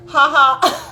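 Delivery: loud high laugh; real laughter. Real, not acted. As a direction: burst out laughing. Laughing at friend's contradiction.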